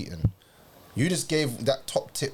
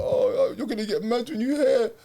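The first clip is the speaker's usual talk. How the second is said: put-on higher, breathy girlfriend voice warning; nervous girlfriend; 10 semitones higher than usual. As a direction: voice her nervously stalling. Retelling girlfriend's nervous confession.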